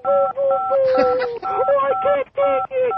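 mocking whine. Mocking the caller who hung up.

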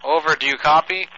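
loud radio operator call; crackly construction walkie talkie. Pretend. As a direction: bark it like a walkie talkie check. Radio operator sign-off on phone.

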